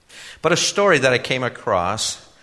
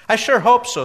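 put-on much higher, earnest young man; eager young man voice; 12 semitones higher, 8 dB louder than usual. A narrator's reading. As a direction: naive youth, pitched up and louder.

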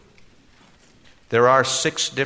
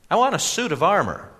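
put-on much higher, earnest young man; eager young man voice; 9 semitones higher, 3 dB louder than usual. A narrator's reading. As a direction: naive youth, pitched up and louder.

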